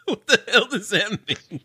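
high, laughing disbelief. Real, not acted. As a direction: ask it through laughter. Co-host laughing at the host's phrase.

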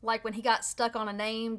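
ordinary talk

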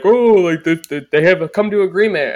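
high, loud, hammed-up reporter voice; a sing-song rumor monger; Jon Heyman impression. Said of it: "he'd be like".

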